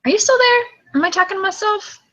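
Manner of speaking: high, tentative rising question; checking if heard. Real, not acted. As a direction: call out to a lost caller. Calls out to dropped caller.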